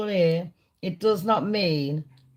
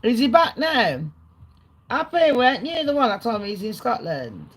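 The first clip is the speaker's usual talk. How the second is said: normal talking voice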